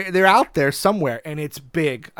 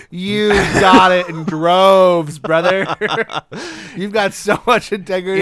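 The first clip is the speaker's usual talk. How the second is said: laughing mock; 4 semitones higher, 9 dB louder, slower than usual. Mocking the "buddy" brush-off.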